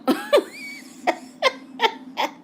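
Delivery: high-pitched burst of laughter; squealing laugh at a friend's joke; real laughter. Real, not acted. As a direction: crack up in a high, helpless laugh. Cracking up at Lena's guess.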